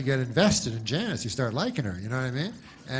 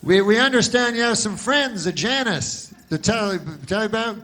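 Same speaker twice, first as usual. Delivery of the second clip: news anchor; 7 semitones higher, 5 dB louder than usual. Retold or imitated. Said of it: "And they go".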